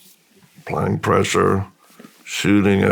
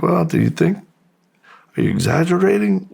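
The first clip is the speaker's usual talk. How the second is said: higher-pitched retold question, rising; mock-questioning counselor, pitched up; imitating doubting counselor; 9 semitones higher than usual. Retold counselor doubts his story.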